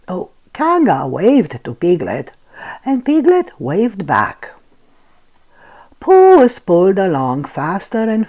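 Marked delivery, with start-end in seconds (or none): none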